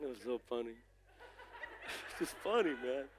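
low, soft words through a laugh; quietly tickled, laughing as you speak; amused laughing. Real, not acted. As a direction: chuckle helplessly at your own story. Laughing at his own story.

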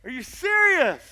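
high, loud mock outrage at the movie heroine; shouting at a horror movie character to finish him; exasperated yelling at the screen. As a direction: yell at the girl on screen. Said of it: "I'm like".

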